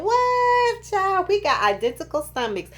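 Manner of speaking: high, held, playful "what"; mock amazement. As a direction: exclaim high like a delighted girlfriend. Acts out her reaction at doctor's.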